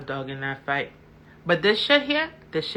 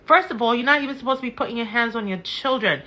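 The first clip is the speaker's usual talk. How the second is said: ordinary delivery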